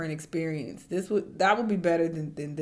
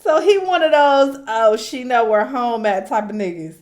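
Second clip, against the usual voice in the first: much higher, loud ridicule; mocking outburst; 5 semitones higher, 15 dB louder than usual. Real, not acted. Mocking the texting fan, loud and high. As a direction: roast him loudly.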